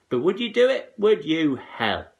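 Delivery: loud, high scolding; mother nagging about baths. A narrator's reading. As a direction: nag him hard.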